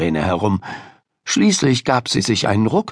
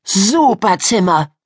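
put-on high, drawn-out girlish rapture; gushing; 9 semitones higher, 3 dB louder than usual. A narrator's reading. Miriam seeing Ida's room, gushing. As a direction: gush slowly in a high voice. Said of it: "schwärmte Miriam".

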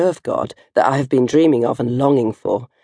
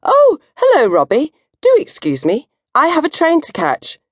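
much higher, breezy put-on casual tone; 13 semitones higher than usual. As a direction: fake nonchalance to escape fast. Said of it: "in a quick thinking and casual way".

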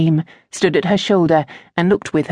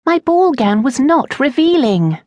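protesting; 8 semitones higher than usual. Acted. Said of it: "Lynette protested".

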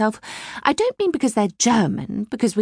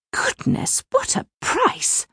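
gasp, then a much higher scandalised cry; shocked gasp; slower than usual. Acted. Mother shocked by a mower's price, gasping and squeaking. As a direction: clutch your pearls at the price.

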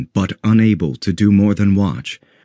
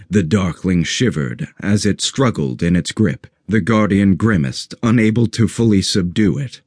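plain reading voice

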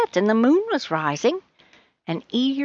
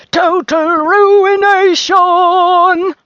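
high, very slow, loud proclamation; suddenly raised voice; 9 semitones higher, 9 dB louder, much slower than usual. A narrator's reading. Rose pronounces Charlie's fate, suddenly loud and drawn out. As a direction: stretch out the doom in a huge cry. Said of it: "she suddenly raised her voice".

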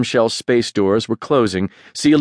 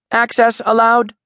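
computer voice; 11 semitones higher than usual. A narrator's reading. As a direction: announce it like a ship's computer. Ship's computer announcement.

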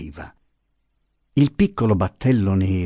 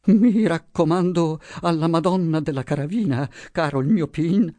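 put-on high, thin frightened old woman's voice; trembling prayer; 8 semitones higher than usual. A narrator's reading. Trembling old wife commending herself to the Madonna, fearful plea. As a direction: pray nervously to the boatman.

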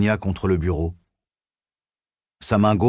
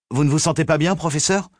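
higher-pitched voice of concern; worried; 7 semitones higher than usual. A narrator's reading. Assistant checks on the ailing professor, in a higher worried voice. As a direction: ask anxiously, pitch raised. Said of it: "d'une voix inquiète".

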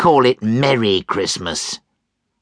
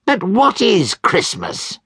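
high, raised ranting voice; angry old miser; 10 semitones higher than usual. Acted. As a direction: build the rant against Christmas. Scrooge ranting.

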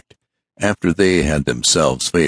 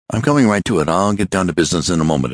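rapid; 3 semitones higher, faster than usual. Acted. Hurried visitor talking.

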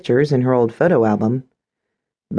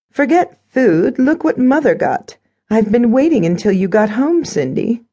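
gloating stepmother; 10 semitones higher than usual. A narrator's reading. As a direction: play the stepmother showing off, pitched high.

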